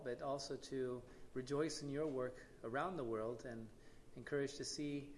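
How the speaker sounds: hushed, sincere prayer to the Lord; opening prayer. Real, not acted.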